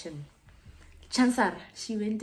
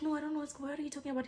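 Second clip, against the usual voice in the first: high-pitched, soft innocent protest; imitated girlfriend, high and evasive; defensive denial; 8 semitones higher, 5 dB quieter, much faster than usual. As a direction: play dumb in a small high voice.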